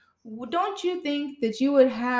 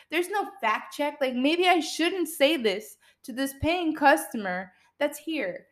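usual speaking voice

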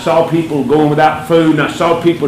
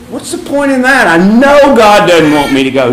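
retold protest; 5 semitones higher, 4 dB louder than usual. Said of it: "And I go".

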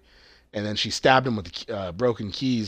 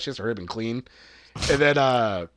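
ordinary delivery

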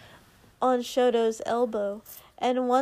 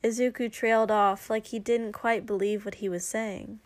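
usual speaking voice

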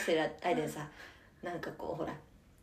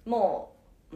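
ordinary delivery

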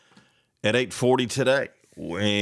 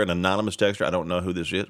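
ordinary delivery

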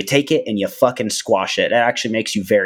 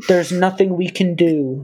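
mock helpless politician; 9 semitones higher than usual. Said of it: "we go".

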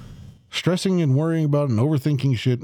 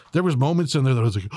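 ordinary delivery

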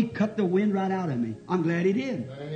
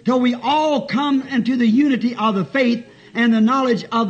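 raised, chanting preacher's reading; forceful scripture reading; 4 semitones higher, 6 dB louder than usual. Real, not acted. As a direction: read the verse out with pulpit force.

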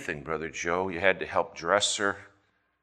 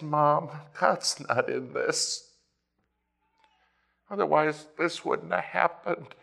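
despairing plea; 7 semitones higher than usual. Retold or imitated. Quoting own despairing words to mom.

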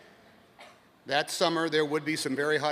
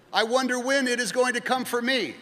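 anxious pilgrim; 9 semitones higher than usual. Acted. Pilgrim answers the sage.

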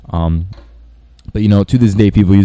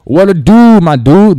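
much higher, louder hammed-up dude greeting; casual customer greeting; 10 semitones higher, 6 dB louder, slower than usual. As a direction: play an exuberant customer saying hey dude. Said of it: "If they say".

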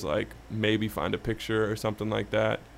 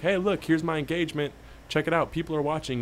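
mock pitch; 6 semitones higher than usual. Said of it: "say, like".

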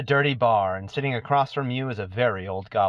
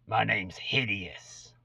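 put-on slow old goblin voice; 5 dB quieter, slower than usual. Old goblin introduces himself.

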